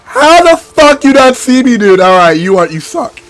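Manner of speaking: usual speaking voice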